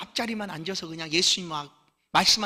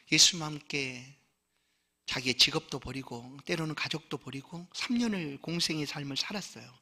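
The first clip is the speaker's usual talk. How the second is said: ordinary talk, nothing marked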